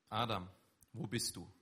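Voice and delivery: calm, seeking call, not accusing; gentle calling. Acted. God calling Adam gently from the garden, seeking not scolding. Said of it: "ganz bewusst diese Betonung".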